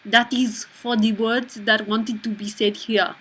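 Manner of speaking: slow, put-on French accent. Shown as a demonstration. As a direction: read it slowly as if French. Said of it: "A French accent".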